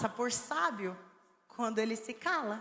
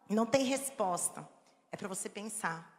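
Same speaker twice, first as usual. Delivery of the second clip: ordinary talk, nothing marked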